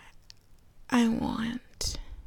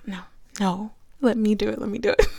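normal talking voice

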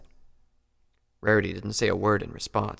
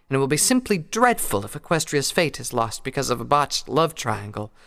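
higher, lilting mare voice; Rarity voice; 6 semitones higher, 5 dB louder than usual. Acted. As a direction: voice Rarity with gentle concern. Rarity speaks to Applejack.

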